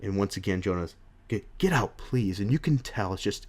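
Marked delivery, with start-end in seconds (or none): 1.3-2.4 soft, faltering plea; acted devastated dismissal, falling away; at breaking point. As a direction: stumble into a defeated, quiet request. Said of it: "he's at his breaking point".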